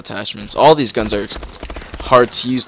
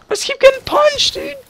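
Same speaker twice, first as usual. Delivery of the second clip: much higher, whining; high whiny complaint; 4 dB louder than usual. Real, not acted. Gamer whining about getting punched, high-pitched. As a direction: squeak out the complaint.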